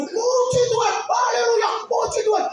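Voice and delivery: leaping at the window, praising God; jubilant praise. Retold or imitated. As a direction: shout for joy. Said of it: "Saya meloncat".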